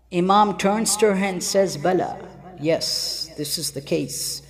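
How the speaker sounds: low and soft, heavy with grief; hushed grieving narration. Acted. Preacher mourning the women's weeping, hushed and sorrowful. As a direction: let the voice sink as if mourning. Grief falls over the tale.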